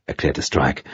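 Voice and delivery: ordinary delivery, nothing marked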